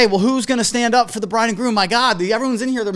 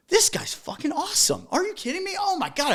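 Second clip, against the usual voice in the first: overheard gushing; 10 semitones higher, 5 dB quieter than usual. Retold or imitated. Guests overheard praising DJ.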